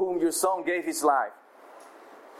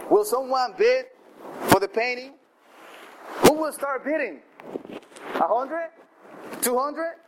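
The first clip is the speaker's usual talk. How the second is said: pressing auctioneer; 7 semitones higher than usual. Acted. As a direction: coax the room into bidding. Auctioneer persisting with bids.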